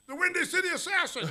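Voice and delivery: high, loud mock announcing; over-the-top comic shout; hammed-up nickname call. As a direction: belt out the nickname like a catchphrase. Mimics shouting a nickname at mirror.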